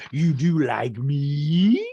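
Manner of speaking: loud, slow, drawn-out questioning; imitated castmate's exaggerated disbelief; mock incredulous. Acts out Rinna's incredulous question.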